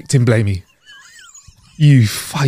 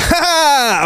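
mock laugh; 12 semitones higher than usual. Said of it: "he's like".

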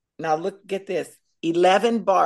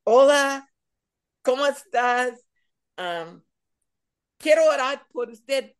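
cheerful greeting; 7 semitones higher than usual. Retold or imitated. Approaching the church women to pray, eager Spanish greeting.

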